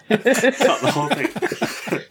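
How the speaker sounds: high, slow laughing echo. Real, not acted. Guest echoing the host while laughing hard. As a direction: laugh the words out.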